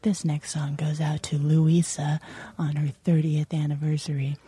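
low, smooth dedication on R&B radio; late-night DJ voice. Retold or imitated. Host dedicates a caller's anniversary song, late-night DJ voice. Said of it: "a late night R and B show".

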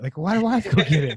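quick, laughing through the words. Retold or imitated. Husband laughing at how he used to grumble over fetching drinks. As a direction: chuckle at your own old pettiness. Laughing at his old attitude.

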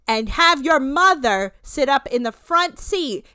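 slow, punched-up dramatic scolding; hammed-up stern lecture, leaning hard on key words; Monique dramatic reading. Podcaster imitating host rebuking two feuding women, dramatic reading. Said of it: "doing a Monique dramatic reading".

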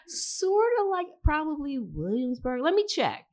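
high-pitched, evasive stalling; retold hedging. Host stalls on friend's question about location, evasive hedging. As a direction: hedge in a high, unsure voice.

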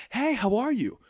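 mock supermodel gushing hello; crazy nice supermodel. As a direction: gush it like an overly friendly model. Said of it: "by being so crazy nice".

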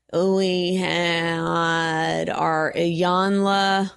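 stretched, mock-grand roll call of a guest; drawn-out recap. Host recapping the show's guests, very slow and drawn-out. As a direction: draw every word out very slowly.

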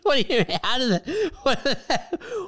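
high-pitched, baffled laughing questions; real giggly incredulity; incredulous laughing. Real, not acted. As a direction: laugh through your disbelief, words breaking up. Incredulous laughing at friend's claim.

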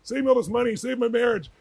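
high, gushing imitation of a starstruck doctor; excited fan doctor. Doctor meeting his idol as a patient, imitated high excited gush. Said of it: "was excited when he met me".

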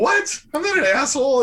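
shocked protest. Retold or imitated. Retelling his stunned reply to his friend's blunt remark, shocked high protest. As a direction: protest like the insult just landed.

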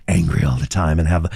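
low, raspy, angry character; Logan. Shown as a demonstration. Voice actor describing his angry role, low rasp.

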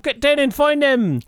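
high, far mock cop yell; shouting cop. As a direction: bark like a raiding cop. Retold police raid shout.

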